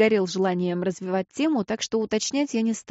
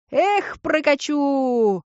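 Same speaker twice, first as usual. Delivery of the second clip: high, slow gleeful holler; joyful yell; 6 semitones higher, 4 dB louder, much slower than usual. Acted. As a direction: whoop it out like a happy driver. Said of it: "радостно орал он".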